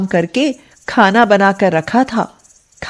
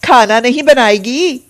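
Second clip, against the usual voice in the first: higher-pitched angry nagging; angry scolding aunt; 5 semitones higher than usual. Acted. Aunt scolds sick niece to cook, angrily. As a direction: lay into the girl for lying in bed.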